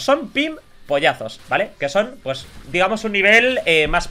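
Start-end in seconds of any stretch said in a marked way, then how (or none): 0.0-1.4 "pimpollazos" drawn out slowly, stressed. Shown as a demonstration. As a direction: stretch "pimpollazos" slowly and clearly. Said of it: "Que no son pollazos". ("pimpollazos" lento y marcado)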